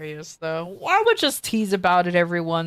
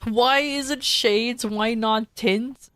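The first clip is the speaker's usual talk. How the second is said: slow musing; 3 semitones higher, much slower than usual. Real, not acted. Musing joke about the title.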